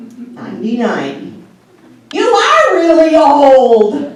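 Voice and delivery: slow, loud, laughing jab; laughing shout of a joke; teasing retort. Retold or imitated. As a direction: tease her about her age. Retort to mother.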